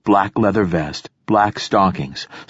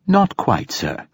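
too smooth android; 12 semitones higher, slower than usual. Acted. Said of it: "replied the android".

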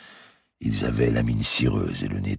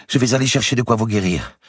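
high, louder burst of resolve; eager cry; 12 semitones higher, 5 dB louder than usual. A narrator's reading. Young man crying out to the sick girl, eager. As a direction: cry out eager to help at once. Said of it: "s'écria-t-il".

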